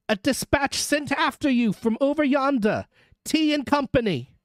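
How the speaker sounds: high-pitched distant messenger; calling the message. A narrator's reading. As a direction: deliver news across a distance. Messenger calls news through mist.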